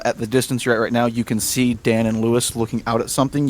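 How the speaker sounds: ordinary talk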